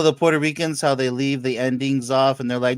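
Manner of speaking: usual speaking voice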